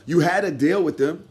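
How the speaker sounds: normal talking voice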